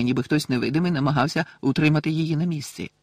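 usual speaking voice

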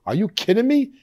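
a mock gasp of shock. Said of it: "now I'm, like, shocked".

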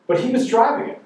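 put-on high, protesting teen voice; mock whiny teenager. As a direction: push back like a defensive son. Son protests.

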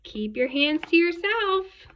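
loud warning climbing in pitch; high, loud telling-off; scolding teacher. A narrator's reading. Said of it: "David's teacher always said".

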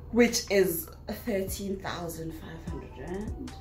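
usual speaking voice

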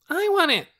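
put-on high, loud childish demand; demanding cry. A narrator's reading. Torin wants the only egg, demanding cry. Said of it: "cried Torin".

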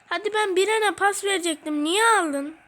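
fast annoyed complaint. Real, not acted. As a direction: protest at the opponent. Ball stolen from him.

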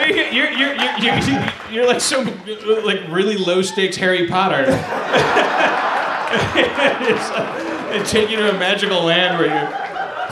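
loud, laughing, breathless teasing; laughing tease. Real, not acted. Teasing Jeff about free stuff, loud laughing. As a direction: tease him while cracking up.

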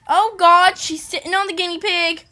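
loud, high exclamation; alarmed outcry. Real, not acted. Owner seeing bunny sit on guinea pig, loud alarm. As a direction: cry out in sudden alarm.

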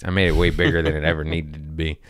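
ordinary talk

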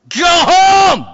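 high, drawn-out acted yell; shouted order. Gang leader chasing off the kid, acted shout. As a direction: bellow it to send the kid running.

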